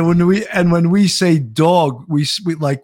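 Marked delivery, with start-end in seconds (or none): 1.4-2.0 "dog" drawn into "dawg"; New York "dog". Shown as a demonstration. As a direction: stretch the vowel of "dog" to a long aw. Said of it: "we say it like D A W G".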